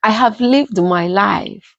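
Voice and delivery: calm the crying family, slowly; a lightly voiced old grandmother; grandma's comfort. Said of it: "she said".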